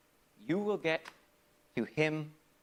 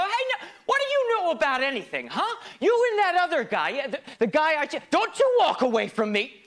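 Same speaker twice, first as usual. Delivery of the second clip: put-on higher, louder snapping at the kid; angry outburst; 8 semitones higher, 6 dB louder than usual. Older self snapping at his child self, angry shout. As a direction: tell off the little pissant, voice climbing. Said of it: "So you go".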